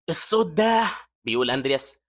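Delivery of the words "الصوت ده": high, strained-sounding pained words. A narrator's reading. Hazar hurting at the mysterious voice, pained. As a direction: react as if the sound hurts. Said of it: "وهو موجوع".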